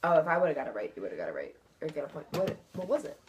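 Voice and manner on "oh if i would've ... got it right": ordinary talk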